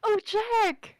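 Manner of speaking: loud, high, delighted hello; excited greeting. Real, not acted. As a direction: greet him like a long-lost friend. Greeting a friend joining call.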